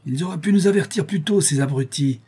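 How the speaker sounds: high-pitched angry outburst; deputy mayor raging, pitched high. A narrator's reading. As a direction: spit it out in fury. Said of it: "rageait le vice-maire".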